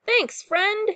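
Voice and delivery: high, grateful call-out; loud, happy storybook thanks; captain's yell. A narrator's reading. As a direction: yell your thanks to a pal. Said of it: "yells Captain Pete".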